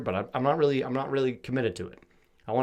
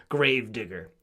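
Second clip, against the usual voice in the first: normal talking voice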